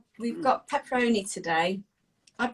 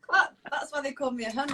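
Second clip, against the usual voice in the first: normal talking voice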